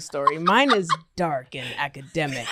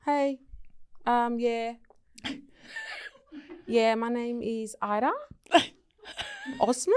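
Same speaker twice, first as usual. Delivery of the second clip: fake Australian accent; 3 semitones higher, 6 dB quieter than usual. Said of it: "I'm Australian".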